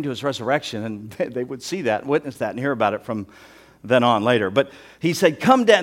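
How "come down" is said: high, loud taunting call; crying out. Acted. Crowd calling to Jesus on the cross, crying out. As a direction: hurl it up at him. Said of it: "were crying out, hurling at him".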